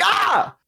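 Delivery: startled yelp. Retold or imitated. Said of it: "You'd be like".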